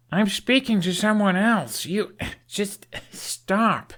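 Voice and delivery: exasperated plea to back off; flustered. Acted. Hiccup pleading with his dragon to stop, high flustered.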